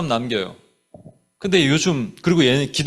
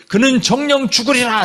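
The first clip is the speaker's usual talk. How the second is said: mock furious verdict; 7 semitones higher than usual. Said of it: "다윗이 막 격분하면서".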